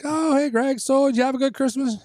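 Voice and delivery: high, bright mock dad. Dad asks son about his Christmas, high mock dad voice. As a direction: put on a high chirpy dad voice.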